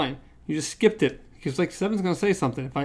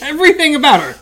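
excited; 10 semitones higher, 9 dB louder, faster than usual. Real, not acted. Laughing at the giant woman.

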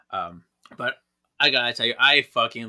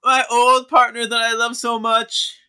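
big dramatic reveal; 13 semitones higher, 12 dB louder than usual. Acted. Reading the spirit's reveal to her co-host, loud and thrilled.

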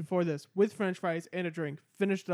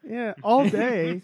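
drawn out; 4 semitones higher, 6 dB louder, much slower than usual. Real, not acted. Drawn-out agreement.

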